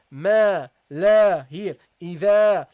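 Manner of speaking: ma, la, idha, alif kept light and thin; loud, slow syllables with a light alif; teacher calls out light-alif syllables loudly; light alif examples. Shown as a demonstration. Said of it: "you pronounce it lightly".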